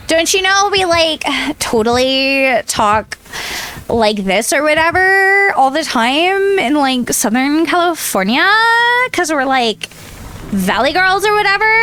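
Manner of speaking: put-on licht gespeeld Valley Girl-accent, langgerekt 'like'; a hint of a Southern California valley girl, sarcastic; mock Valley Girl. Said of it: "Valley Girl voice".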